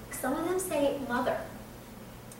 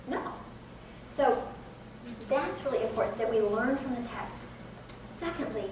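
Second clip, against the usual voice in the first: ordinary talk, nothing marked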